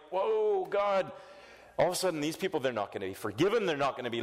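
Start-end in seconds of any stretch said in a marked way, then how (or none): 0.1-1.1 startled whoa. Retold or imitated. Preacher reacting to harsh verses, surprised. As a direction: react as if caught off guard. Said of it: "I read this and I'm like".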